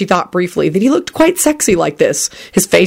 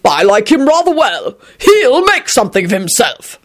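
hearty, raised-pitch endorsement; mildly booming gentleman; 6 semitones higher than usual. A narrator's reading. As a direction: declare it stoutly. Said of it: "Richard boomed".

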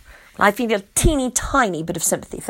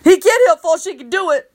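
egging on; 7 semitones higher, 5 dB louder than usual. A narrator's reading. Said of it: "egging me on".

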